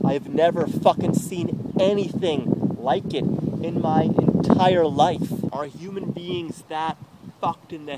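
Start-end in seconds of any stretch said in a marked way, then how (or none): 0.1-5.3 angry outburst. Real, not acted. Ranting to the camera about his ex, a loud furious outburst. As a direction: hammer it out in real anger.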